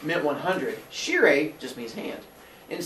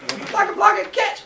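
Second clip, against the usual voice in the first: high monster babble; playful giant calling catch; 4 dB louder than usual. Retold or imitated. Monsters throwing mountains.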